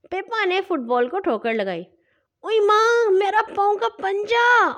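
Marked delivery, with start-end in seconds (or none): 2.4-4.7 high, loud pained squeal; yelp of pain. A narrator's reading. Pippa kicks the ball and squeals in pain, very high and loud. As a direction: cry out as the toe hurts. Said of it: "وہ چیخی".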